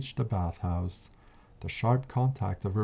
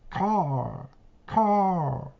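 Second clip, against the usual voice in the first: shrill crow cry; 9 semitones higher than usual. A narrator's reading. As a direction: cry out twice like a bird of ill omen. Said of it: "in a shrill voice cried out twice".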